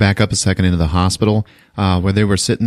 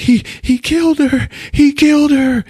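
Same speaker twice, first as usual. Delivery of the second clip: high, loud distraught voice; retold boy breaking down; grieving cry; 4 dB louder than usual. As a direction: wail it in grief. Jake grieving his mom.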